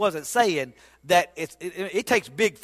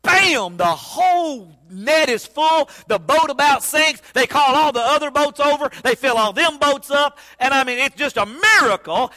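loud, high, fired-up telling of the big catch; preaching at full force, lifting the crowd; excited storytelling; 5 semitones higher, 5 dB louder than usual. Acted. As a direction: swell with excitement as the net fills. Preacher building excitement over catch.

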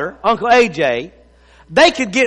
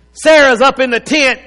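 ordinary talk, nothing marked

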